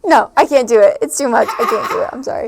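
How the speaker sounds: nervous laughing. Real, not acted. Speaker abandoning her accent attempt, nervous laughing. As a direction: give up through embarrassed laughter. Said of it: "she got too nervous".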